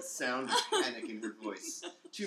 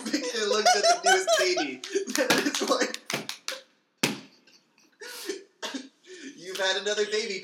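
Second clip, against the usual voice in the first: laughing while reading; 8 semitones higher, 8 dB louder than usual. Real, not acted. Laughing through absurd birth scene.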